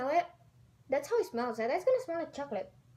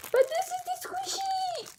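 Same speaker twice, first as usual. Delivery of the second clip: much higher, sing-song squeal; excited squeal. Real, not acted. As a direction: show it off, thrilled. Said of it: "I'm so excited".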